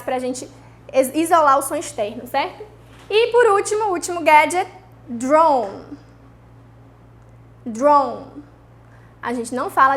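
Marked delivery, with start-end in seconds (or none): none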